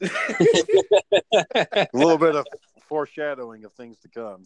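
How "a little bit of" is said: words swallowed by a big laugh. Real, not acted. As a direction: crack up while saying it slowly. Laughing at own story.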